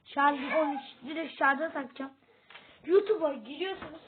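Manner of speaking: ordinary talk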